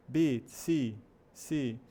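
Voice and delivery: "B, C, C" with stretched ee; long vowel. Shown as a demonstration. Teacher modelling the lengthened sound, long ee in "B, C, C". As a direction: hold each ee in "B, C, C". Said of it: "ele é um som mais alongado".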